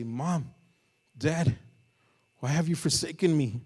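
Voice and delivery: put-on loud, high cry of a scared child; a dying son crying out for his parents; anguished cry. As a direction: call out to Mom and Dad in anguish.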